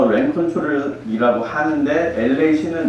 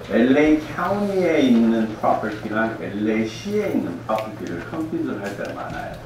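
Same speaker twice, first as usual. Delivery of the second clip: ordinary talk, nothing marked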